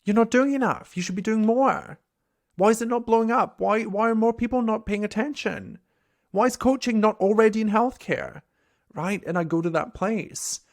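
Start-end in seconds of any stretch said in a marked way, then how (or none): 0.1-8.4 a hint of a nagging self-critic, higher and louder; harsh inner critic. Coach voicing her own inner critic, mildly higher and louder. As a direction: berate yourself like an impatient boss. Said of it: "sometimes I'm super shitty to myself".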